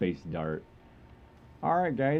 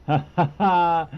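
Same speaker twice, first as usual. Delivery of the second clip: loud-ish, higher-pitched ha-ha laugh; 6 semitones higher, 4 dB louder than usual. Real, not acted. Streamer amused at spotting another ship, laughing. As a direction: burst into a short string of ha-has.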